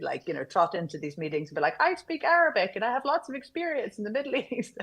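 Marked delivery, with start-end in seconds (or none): 1.8-4.8 put-on higher, eager young voice breaking into a laugh; bright-eyed young hopeful, laughing at herself; naive earnest kid. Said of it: "this little earnest kiddo".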